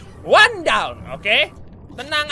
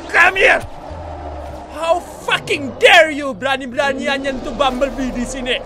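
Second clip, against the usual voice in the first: louder and higher, outraged yell; furious shout; 4 semitones higher, 6 dB louder than usual. Real, not acted. Gamer yelling at a monster attacking his submarine, furious. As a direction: roar at the creature that touched your sub.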